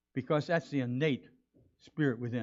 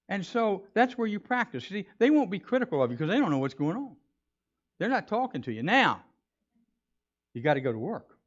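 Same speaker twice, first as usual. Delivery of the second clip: ordinary talk, nothing marked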